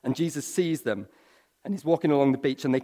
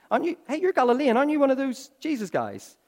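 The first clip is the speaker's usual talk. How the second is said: much higher, fast, needling villager; accusing bystander; 11 semitones higher, faster than usual. Retold or imitated. Stranger outside the gates accusing Peter, high fast needling. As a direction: pounce on him like a nosy stranger. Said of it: "Someone even recognizes him by his accent".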